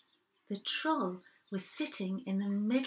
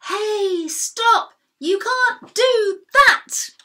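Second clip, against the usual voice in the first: much higher and louder playful yell; imitating people shouting at the goats; mock shout; 14 semitones higher, 13 dB louder, much slower than usual. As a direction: bellow it like a grumpy grown-up. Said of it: "They shout like this".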